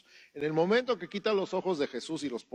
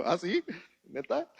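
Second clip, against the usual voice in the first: high, rising mock disbelief; the others can't believe what they heard; incredulous; 5 semitones higher than usual. Preacher imitating the group turning round in surprise, high disbelief.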